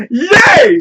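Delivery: a loud, high whoop of joy; excited cheer. Real, not acted. Cheering for the band's song.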